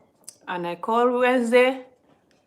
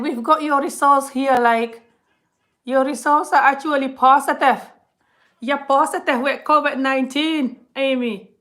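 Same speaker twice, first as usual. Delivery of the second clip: loud, raised-pitch imitation Scottish accent; Scottish woman impression; 6 semitones higher, 7 dB louder than usual. Scottish hospital woman giving the patient test results, mock Scottish accent. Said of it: "This hospital was a Scottish woman".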